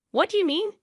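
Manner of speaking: high, loud indignant challenge. A narrator's reading. Mother-in-law challenging her daughter-in-law, indignant.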